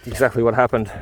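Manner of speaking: ordinary talk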